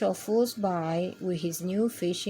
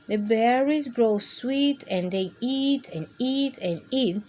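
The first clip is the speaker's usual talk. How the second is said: ordinary delivery, nothing marked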